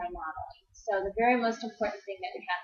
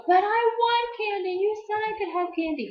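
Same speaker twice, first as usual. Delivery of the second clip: put-on whiny kid; 10 semitones higher, 7 dB louder than usual. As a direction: drag it out like a kid begging for candy. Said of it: "If they go".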